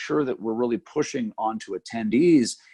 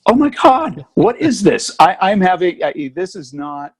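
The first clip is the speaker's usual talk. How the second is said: real loud exasperated outburst, higher; exasperated surprise; 7 semitones higher, 8 dB louder, slower than usual. Real, not acted. Speaker interrupted by his ringing phone, loud exasperated surprise. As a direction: blurt it out, caught off guard by the phone.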